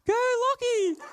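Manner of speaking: high mock cheer from the crowd; cheering shout. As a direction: call it out high like a fan on the sidelines. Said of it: "I just hear a".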